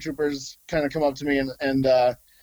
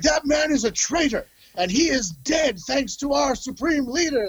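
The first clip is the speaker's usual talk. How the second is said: pompous First Order officer; 10 semitones higher than usual. Retold or imitated. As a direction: lecture him on the traitor in a much higher officer voice. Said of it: "He was like".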